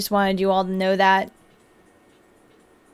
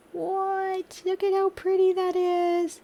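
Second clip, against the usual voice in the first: soft, high, drawn-out wonder; hushed awe; 8 semitones higher, 9 dB quieter, slower than usual. Real, not acted. Streamer shows off a pretty build, high hushed cooing. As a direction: coo it quietly, way up high.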